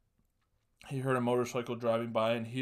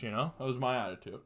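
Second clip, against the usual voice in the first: ordinary delivery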